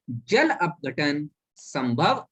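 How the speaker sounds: slow, spaced "जल अपघटन संभव"; slow dictation. Shown as a demonstration. Teacher dictating the Hindi term slowly. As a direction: spell it out word by word. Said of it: "एक बार और बोले दे रहा हूं".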